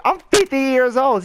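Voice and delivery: high-pitched mock indignation; indignant. Man answering the woman's baby insult, indignant. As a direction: imitate an affronted man, voice jumping up.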